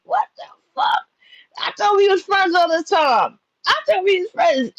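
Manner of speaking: high, stunned yell of disbelief; shocked friend yelling what the fuck; shocked outburst. Retold or imitated. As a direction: cry out in shock, then hold the pitch up.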